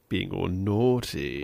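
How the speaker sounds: low, slow creepy narrator voice; Freaky Fred. Retold or imitated. Imitating Fred narrating the shaving episode, low slow creepy voice. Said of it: "him narrating as well. He keeps going".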